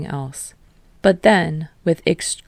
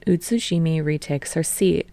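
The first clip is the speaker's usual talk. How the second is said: usual speaking voice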